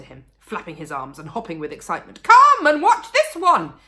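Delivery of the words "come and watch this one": loud, high, slow call across the yard. A narrator's reading. Mister Cranky calling to Missus Cranky, loud call. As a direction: call her out to watch. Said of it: "he called out".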